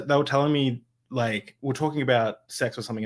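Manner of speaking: usual speaking voice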